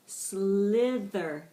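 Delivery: "Slither" drawn out long through both syllables; slowed-down pronunciation. Shown as a demonstration. Teacher modelling "Slither" for the class, slowed right down. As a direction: stretch out "Slither" slowly and firmly. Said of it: "with varying degrees of speed".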